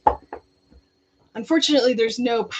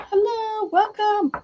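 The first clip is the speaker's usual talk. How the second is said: high, bright welcome; cheerful greeting; 12 semitones higher, 3 dB louder, slower than usual. Real, not acted. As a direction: greet newcomers warmly. Greeting arriving viewers.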